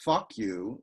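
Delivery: "Fuck" stressed, "you" light; trochee stress. Shown as a demonstration. Poet showing the trochee to listeners, stress on first word. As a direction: lean on "Fuck" and let "you" fall away. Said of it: "with the stress on the first syllable".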